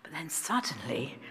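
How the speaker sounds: soft, low, near-whispered narration; hushed storytelling. A narrator's reading. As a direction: hush it like a sleeping temple at night. Hushed scene of sleeping temple.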